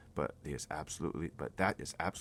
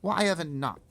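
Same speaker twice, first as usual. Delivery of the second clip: Sophie, high and urgent, much louder; a small girl pleading to stop the giants; high girl's voice; 13 semitones higher, 12 dB louder than usual. Acted. Girl begging the giant, high and loud.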